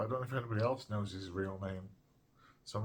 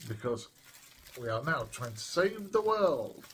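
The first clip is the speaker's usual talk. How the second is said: ordinary delivery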